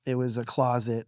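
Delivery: ordinary talk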